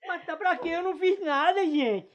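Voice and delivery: high, loud frightened denial; panicked protest. Acted. Man protesting his innocence to the furious father, frightened. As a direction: insist you did nothing, scared stiff.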